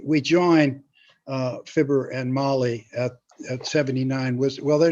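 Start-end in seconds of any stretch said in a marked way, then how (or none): none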